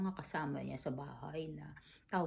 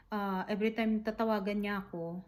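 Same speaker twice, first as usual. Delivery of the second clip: ordinary talk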